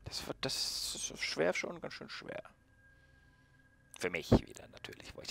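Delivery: muttering. Real, not acted. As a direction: mutter it under your breath. Gamer muttering to himself.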